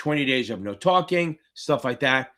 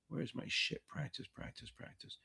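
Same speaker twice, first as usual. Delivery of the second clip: whispered; 20 dB quieter than usual. Real, not acted. Muttering while searching for playlist.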